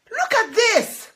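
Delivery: high loud call to the ducklings; cry out. Acted. Sparrow crying out to the ducklings, high and loud. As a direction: wave the note at them. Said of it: "cried Sparrow".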